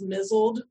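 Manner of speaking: "mizzled", rhyming with fizzled; mispronounced "misled". Shown as a demonstration. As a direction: say "mizzled" with stress on MIZ. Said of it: "had mispronounced misled as".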